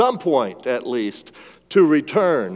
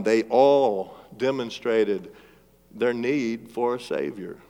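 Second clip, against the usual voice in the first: slow, softer "they all demonstrated their need for a Savior" spaced out for note-taking; slow dictation; 3 dB quieter than usual. Shown as a demonstration. Preacher giving outline point to note-takers, slow dictation. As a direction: dictate it slowly so they can write. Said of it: "If you're filling in your outline".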